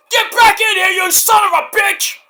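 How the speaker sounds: high, loud hollered insult; angry yell. Retold or imitated. Wrestler calling Edge back to the ring, furious mock yell. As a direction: yell after someone walking off.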